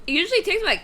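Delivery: usual speaking voice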